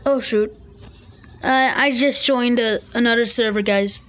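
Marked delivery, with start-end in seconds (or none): none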